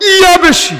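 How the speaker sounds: Yeah thrown high and loud; emphatic exclamation. Acted. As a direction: hammer yeah in high-pitched insistence. Mock insistence that the load is heavy. (ইয়া শব্দে চড়া গলায় জোর)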